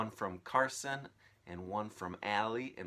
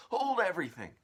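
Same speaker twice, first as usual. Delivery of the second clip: high, loud halt; alarmed command; 11 semitones higher, 10 dB louder, faster than usual. Acted. Aunt halting all, high and loud. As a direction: freeze everyone with it.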